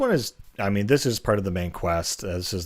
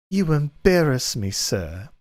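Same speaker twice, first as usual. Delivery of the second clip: high, prim and flirty maid, raised pitch; hammy, high coy servant voice; 8 semitones higher, 4 dB louder than usual. Acted. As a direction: play the bashful Argonian maid, hammed up. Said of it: "inappropriate voice I can muster".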